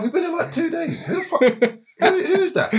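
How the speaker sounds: high, laughing through the words; cracking up in disbelief; laughing incredulous squeak. Real, not acted.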